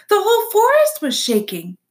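high, loud, anxious fox voice; a worked-up little fox; excited fox. A narrator's reading. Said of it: "the fox panted".

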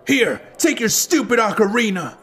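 high-pitched petulant toss-off; spiteful young thief. A narrator's reading. Thief flings ocarina back while fleeing, spiteful. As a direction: throw it back in a kid's high voice.

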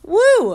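loud, high "woo"; whoop. Real, not acted. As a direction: let out a high whoop to recover from laughing. Recovers from a laughing fit.

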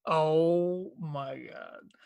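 slow drawn-out "oh my God"; amused disbelief. Real, not acted. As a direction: stretch it out in disbelief. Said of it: "sell me a pack of Tampax".

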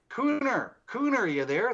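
high, searching call; imitated calling out. Investigator retells woman calling her dead brother, imitated call. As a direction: call the nickname out high, as if into empty space.

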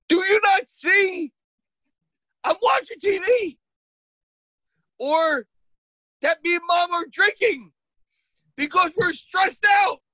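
high, strained exasperated dad rant. Retold or imitated. Dad interrupted at his TV by his kid, exasperated.

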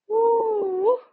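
knowing oh; mock surprised oh. A narrator's reading. Two women reading a card together, mock surprised.

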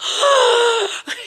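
sharp high gasp of surprise; surprised gasp. Retold or imitated. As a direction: gasp like you just saw a gift. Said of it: "we're both like".

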